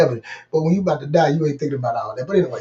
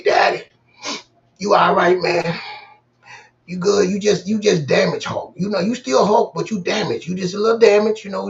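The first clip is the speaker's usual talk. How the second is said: higher, louder light raspy child's voice, a mild imitation; lightly voicing a young son cheering up dad in hospital; imitating a raspy kid; 6 semitones higher, 4 dB louder than usual. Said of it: "he got a raspy voice".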